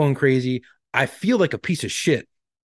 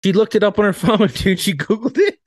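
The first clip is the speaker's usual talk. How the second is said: laughing; 8 semitones higher, 5 dB louder than usual. Real, not acted. Laughing at the doctor's answer.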